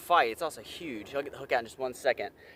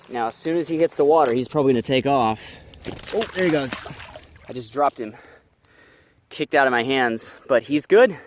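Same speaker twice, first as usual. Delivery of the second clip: louder excited narration at the creek; loud over water; 2 semitones lower, 11 dB louder than usual. Real, not acted. Releasing the fish, talks loudly to camera, excited. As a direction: call it out over the splashing.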